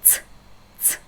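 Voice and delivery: ts said sharp, like it's; Russian ts sound demo. Shown as a demonstration. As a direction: clip a quick ts. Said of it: "this is the sound".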